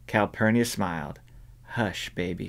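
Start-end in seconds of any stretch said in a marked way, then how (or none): 1.8-2.5 put-on soft hushing to a child; quiet soothing whisper to a child. A narrator's reading. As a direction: hush the little one gently. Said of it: "she whispered".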